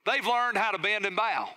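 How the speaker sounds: ordinary talk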